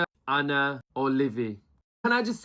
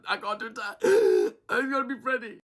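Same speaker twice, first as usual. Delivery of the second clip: mock upset; 8 semitones higher, 4 dB louder than usual. Recapper mimics a contestant refusing to vote, mock upset. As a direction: whimper it with a hammed-up gasp. Livy's upset reaction acted out.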